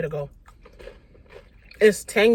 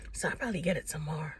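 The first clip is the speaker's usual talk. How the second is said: whispering; 9 dB quieter than usual. Real, not acted. Aside about the long line.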